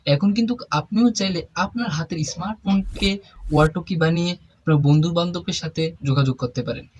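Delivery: normal talking voice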